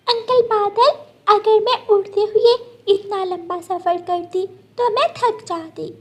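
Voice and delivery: put-on a small child asking how to get home; little bird child. Gudiya asking the cloud for help, high worried child voice.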